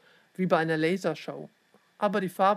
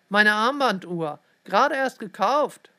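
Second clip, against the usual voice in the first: shocked dismay; 3 semitones higher, 7 dB louder than usual. A narrator's reading. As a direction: exclaim over the sudden loss. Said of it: "Doch dann erstarrte er".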